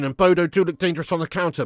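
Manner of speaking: usual speaking voice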